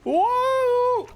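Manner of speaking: very high startled whoa; startled yelp. Acted. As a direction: jump in surprise. Sudden startled outburst.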